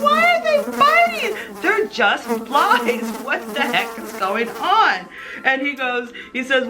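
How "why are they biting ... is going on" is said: bewildered. Retold or imitated. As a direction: exclaim in confused disbelief. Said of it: "And I'm going".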